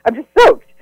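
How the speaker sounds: high, startled question; mock surprise at a lick; surprised. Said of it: "you're like".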